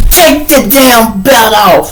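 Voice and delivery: slow, high-pitched order; mad. Acted. Miss Washington stripping patrol belts, mad slow command. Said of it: "she was mad".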